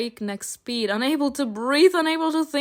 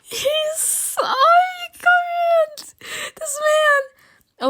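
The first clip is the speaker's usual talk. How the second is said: swooning squeal; much slower than usual. Real, not acted. Swooning over the angel.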